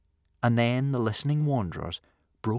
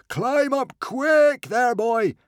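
high, drawn-out bellow; roared command; 9 dB louder, much slower than usual. A narrator's reading. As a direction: bellow down to the boy. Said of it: "he roared".

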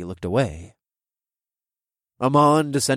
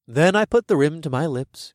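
plain reading voice

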